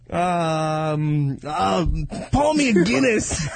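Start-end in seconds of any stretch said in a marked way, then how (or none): 2.3-3.5 a hammed-up Irish accent, higher and laughing; mock Irish pub order; bad Irish accent. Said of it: "Do an Irish one".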